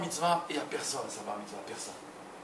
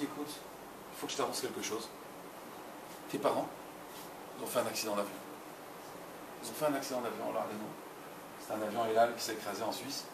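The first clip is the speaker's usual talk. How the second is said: much softer, low and heavy; grave hushed news; 4 semitones lower, 8 dB quieter than usual. Acted. Grandmother softly tells the boy his parents died, hushed and grave. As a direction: break the terrible news gently.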